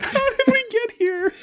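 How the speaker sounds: high wail of real, tearful laughter; crying-with-laughter squeal; laughing hard. Real, not acted. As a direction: ask it while helpless with giggles. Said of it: "last time I laughed that hard".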